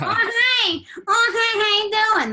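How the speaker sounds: very high, loud, whiny-odd character squeal; exaggerated high-pitched sketch-character voice; It's Pat character voice. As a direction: push it way up into a loud, cartoonish greeting. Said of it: "That's how she talked".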